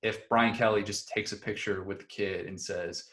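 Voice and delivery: normal talking voice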